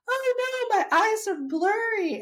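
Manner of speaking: high, panicky mock alarm; mock freak-out. Retelling taking off the mask, in mock panic. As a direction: squeal it like sudden panic. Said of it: "and just totally freak out".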